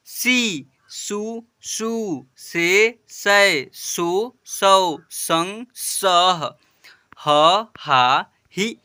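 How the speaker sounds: slow, drawn-out chant of each syllable; syllable drill. Shown as a demonstration. Teacher reading the chart to children, slow drawn-out recitation. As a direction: recite each letter clearly for pupils to repeat.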